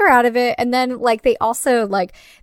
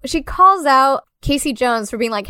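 usual speaking voice

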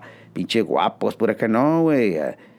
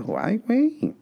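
mock amazement; 14 semitones higher, slower than usual. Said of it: "me quedo".

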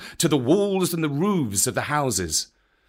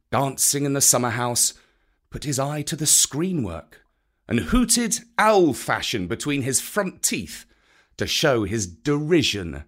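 plain reading voice

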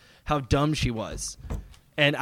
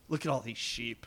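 quiet mock sneer; 4 semitones lower, 15 dB quieter than usual. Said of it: "points at everyone in the park and goes".

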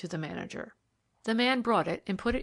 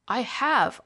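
louder, higher, insistent outburst; impatient cry; 4 semitones higher, 6 dB louder than usual. A narrator's reading. Patient cutting in on his friend, an impatient cry. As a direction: cut in with impatient emphasis. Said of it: "I cried impatiently".

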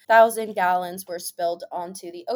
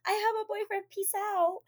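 higher, softer dismissive brush-off; mock sweet brush-off; 10 semitones higher, 3 dB quieter than usual. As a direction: sweetly wave him off. Brushing off man at bar.

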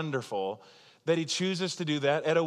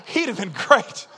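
laughing; 7 semitones higher, 8 dB louder, slower than usual. Real, not acted. Laughing at wedding joke.

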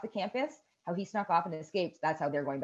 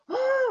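imitated startled yelp.

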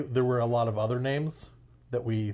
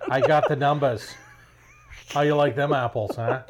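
put-on Boston accent; 3 semitones higher, 7 dB louder than usual. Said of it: "I can't do a Boston accent".

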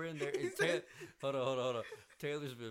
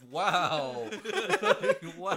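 much louder, laughing through it; laughing mock wow; 6 semitones higher, 12 dB louder than usual. Laughing while imitating a worker fleeing. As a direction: blurt it out cracking up. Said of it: "He's like".

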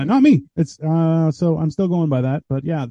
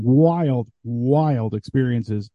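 slow emphasis; 4 semitones lower, much slower than usual. Real, not acted. Drawn-out emphasis on wild.

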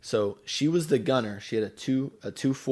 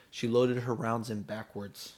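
ordinary delivery

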